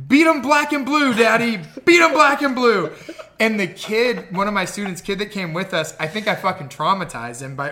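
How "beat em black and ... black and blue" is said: put-on high, loud little-kid cheering-on; mock child's yell, high and insistent; imitated yelling daughter. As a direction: egg dad on like a yelling child. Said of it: "my daughter is yelling".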